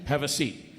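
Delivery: ordinary talk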